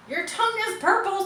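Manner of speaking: put-on high, excited squeal of a girl; screamed. A narrator's reading. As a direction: shriek it up high at her brother. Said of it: "screamed Fern".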